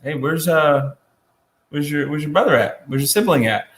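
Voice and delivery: a light touch of a suspicious parent; mild parental check-in, half-teasing; parent scolding. Retold or imitated. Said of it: "our parents go".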